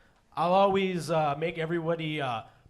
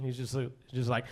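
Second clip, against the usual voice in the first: ordinary delivery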